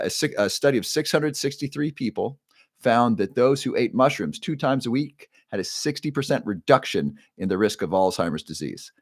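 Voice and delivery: usual speaking voice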